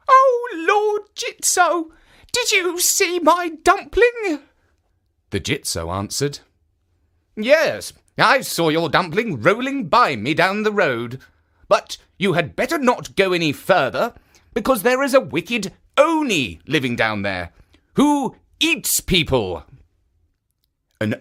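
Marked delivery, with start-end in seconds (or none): 0.1-4.4 put-on high, slow pleading old woman's voice; old woman asking. A narrator's reading. Old woman asks the statue. 7.4-19.6 lightly voiced statue. A narrator's reading. Statue warns the woman.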